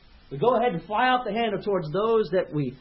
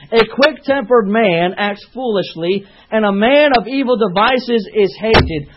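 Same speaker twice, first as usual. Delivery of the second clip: forceful preaching; 11 dB louder than usual. Acted. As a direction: preach it out to the congregation, full force.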